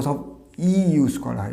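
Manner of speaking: normal talking voice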